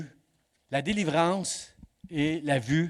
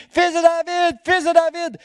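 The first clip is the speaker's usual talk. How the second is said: high, loud call of fils de David; blind man's cry; 9 dB louder than usual. Retold or imitated. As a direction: shout to be heard over the crowd. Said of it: "Il crie".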